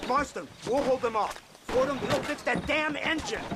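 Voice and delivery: high, urgent battle orders; rally the men under fire; urgent commands. Acted. Agent orders Marston and Fordham during gunfight, urgent.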